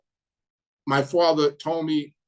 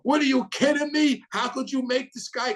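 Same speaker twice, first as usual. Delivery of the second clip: high-pitched retold scream, much higher; 10 semitones higher than usual. Tony Lee in the car screaming at Angelo, high retold yell. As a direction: imitate an outraged yell, pitched way up. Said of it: "Tony Lee was screaming".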